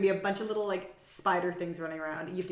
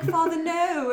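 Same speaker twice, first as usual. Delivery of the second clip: put-on pleading children; 9 semitones higher, 6 dB louder, slower than usual. Retold children protesting to father.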